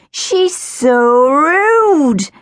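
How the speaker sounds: playful, exaggerated mock scold; teasing. A narrator's reading. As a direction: drag it out to tease a friend. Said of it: "Ella teased".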